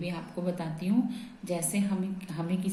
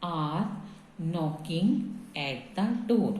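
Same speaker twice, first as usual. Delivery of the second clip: slow dictation; much slower than usual. Shown as a demonstration. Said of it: "ये मैं आपको बता रही हूँ".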